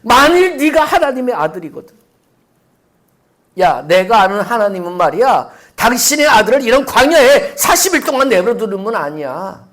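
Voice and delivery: loud, high scornful jeer; taunting tempter. Acted. Tempter taunting fasting Jesus, loud scornful jeer. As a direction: sneer it down at the worn-out man. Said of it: "지금 그 상황을 얘기를 하고 있는 거잖아요".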